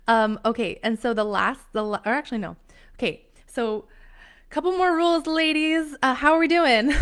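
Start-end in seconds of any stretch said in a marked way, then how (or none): 4.5-6.9 loud, high, playful host call; rally the audience with a big high voice; upbeat hollering to a crowd. Real, not acted. Calling out to live audience.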